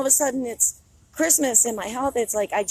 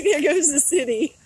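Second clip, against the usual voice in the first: voiced through laughter, higher and louder; laughing mock quote; 5 semitones higher, 4 dB louder than usual. Voicing the disappointed man, laughing through it. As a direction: giggle out his imagined line. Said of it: "He's like".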